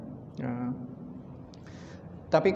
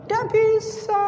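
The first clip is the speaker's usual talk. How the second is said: very high put-on voice, helpless; guest can't explain. Guest admits he can't describe the taste. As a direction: throw up your hands. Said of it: "Bisa menjelaskan?".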